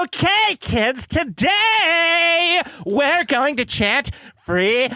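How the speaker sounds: high, slow, shouted drag queen voice; big drag voice. Shown as a demonstration. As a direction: camp it up like a drag host. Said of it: "You gotta do, like, the big drag vo".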